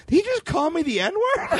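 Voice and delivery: very high, slow mock disbelief; retold delayed disbelief. Son realizing it a mile down the road, mock disbelief. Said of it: "And then I was like".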